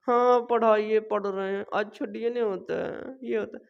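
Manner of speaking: mock bored student, flat and soft. Teacher mocking a bored student, mildly mumbled. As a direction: imitate a listless pupil, voice dropping away. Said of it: "ऐसे नहीं पढ़ना है".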